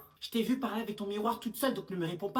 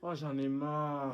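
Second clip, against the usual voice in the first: fed-up groan; 5 semitones lower, much slower than usual. Acted.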